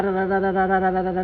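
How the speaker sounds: ordinary talk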